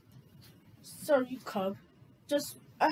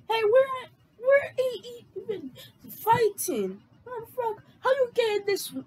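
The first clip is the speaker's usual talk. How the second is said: panicked cry; 9 semitones higher, 3 dB louder than usual. Acted. Panicked character during street fight.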